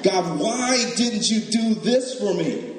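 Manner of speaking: a hint of petulant complaint; mock whining at God. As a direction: whine at God like a spoilt child. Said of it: "churchgoers who get mad at God".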